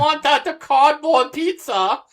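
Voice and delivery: high, slow, whiny mock wife voice pleading for shrimp. Husband mocking his wife who wants shrimp, high and whiny. Mimicking the wife's shrimp request.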